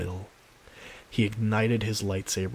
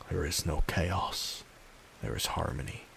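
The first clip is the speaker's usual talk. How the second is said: inner thought; 3 semitones lower, 6 dB quieter than usual. A narrator's reading. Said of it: "he thought to himself".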